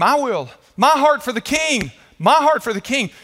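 a preacher voicing a crowd calling out; crowd shouting. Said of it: "people began to scream".

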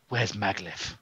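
low, quiet menacing question; trying to intimidate. Acted. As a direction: lean in and ask it softly, intimidatingly. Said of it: "Trying to look all intimidating".

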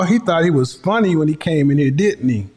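ordinary talk

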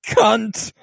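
sharp high yelp of a curse; a hammed-up dying curse; mock yelled insult. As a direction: spit it out like a dying man's last word. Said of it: "And he goes".

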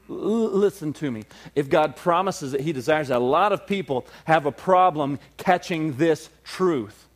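ordinary talk

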